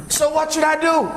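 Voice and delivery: high, questioning chicken voice; eager chicken. Acted. Chicken asking the eagle what to do, high and eager. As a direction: ask it like a hopeful little bird.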